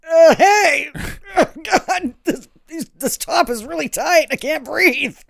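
hammed-up breathless complaint about a tight top; mock squeezed-in bikini girl. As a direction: play a woman gasping in a tiny bikini, half laughing. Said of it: "and I'd be like".

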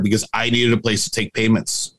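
ordinary delivery

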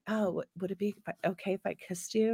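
hushed, hesitant asking; soft and shy. Retold or imitated. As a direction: shyly ask in a soft voice. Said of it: "he was like".

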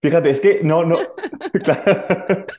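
laughing through the words; can't hold back the laughter; laughing at his own confusion. Real, not acted.

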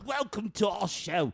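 high, slow, broad fake Cockney; mock Cockney accent. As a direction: welcome them in hammed-up Cockney. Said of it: "He's got a Cockney accent".

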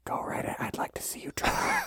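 taunting unvoiced whisper; defiant whisper. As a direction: dare them, barely breathing.

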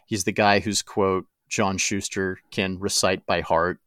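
usual speaking voice

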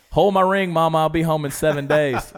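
loud, high, hammed-up holler to Mama; mock calling out. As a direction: call out jokingly to Mama. Joking call to Mama before flying off.

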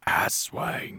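slow, eerie-joke whisper. Acted. Podcaster whispering the dying friend's last words back, whispered. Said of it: "someone just leaning in, whispering".

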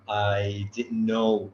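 usual speaking voice

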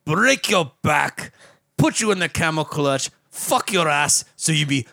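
a hint of the Sheik's broken English; Iron Sheik impression. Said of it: "respect the Fisher; the Iron Sheik".

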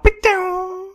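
high whistling ricochet noise; high zing of a ricochet; ricochet whine. Retold or imitated. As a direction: imitate a bullet zinging off metal, pitched high.

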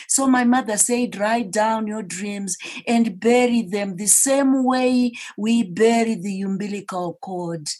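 swelling to a passionate climax; rising passion. Real, not acted. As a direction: preach it with growing force.

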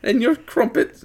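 high, slow British caricature; hammed-up British accent. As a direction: draw it out in a cartoon British squeak. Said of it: "That's just getting worse".

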